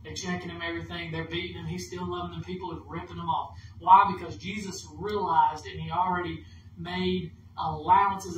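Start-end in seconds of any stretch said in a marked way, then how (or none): 3.8-4.1 sudden loud question. Real, not acted. Preacher to congregation, sudden loud rhetorical cry.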